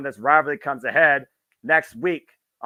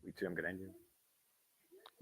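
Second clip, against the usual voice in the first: menacing threat; 6 semitones lower, 16 dB quieter, slower than usual. Retold or imitated. Quoting a menacing promo.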